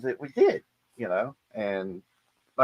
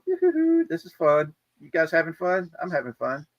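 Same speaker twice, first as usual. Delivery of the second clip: high, cheery yoo-hoo sing-song; carefree sing-song; 6 semitones higher than usual. Retold or imitated. Sailor chirping cheerfully to shivering shipmates, high sing-song. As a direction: bop along like it's a summer stroll. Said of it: "bebopping along".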